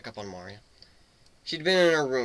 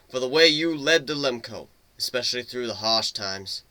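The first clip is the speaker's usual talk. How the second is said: a hint of a proud chief's farewell speech; funeral eulogy; 6 dB louder than usual. A chief honoring a fallen rival at her funeral, lightly voiced and solemn. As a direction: speak of the fallen leader with respect.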